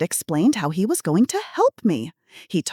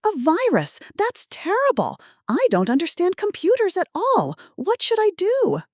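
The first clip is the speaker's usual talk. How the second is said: much higher, fluttery helpless granny voice; confused old lady; 11 semitones higher than usual. Acted. Pretend granny panicking to the scammer, high and fluttery. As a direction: sound lost and harmless to the caller. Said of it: "switching to an elderly woman's tone".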